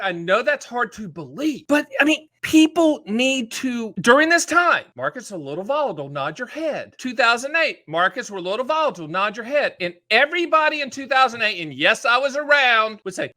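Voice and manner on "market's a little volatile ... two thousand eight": usual speaking voice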